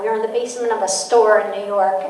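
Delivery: ordinary delivery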